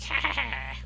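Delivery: high, loud Wicked Witch cackle. Retold or imitated. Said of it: "speak like the, the Wicked Witch".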